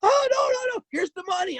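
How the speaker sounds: high, panicked mock shriek of a clerk at gunpoint; a clerk squealing in fright; retold panicked scream. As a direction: shriek it like she's terrified, then drop off. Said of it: "She starts screaming".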